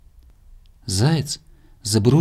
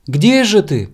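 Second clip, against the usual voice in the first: high, loud hurry-up call; impatient urging; 7 semitones higher, 8 dB louder, slower than usual. A narrator's reading. Squirrel hurrying the lagging hare, high loud urging. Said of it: "торопила белка".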